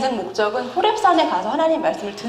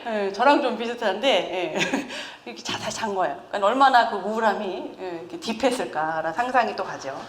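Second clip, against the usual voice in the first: ordinary delivery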